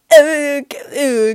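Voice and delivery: long, loud drawn-out "äh"; hammed-up dithering noise; hesitant groan. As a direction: stall with an exaggerated groaning filler. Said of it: "Ich meinte so".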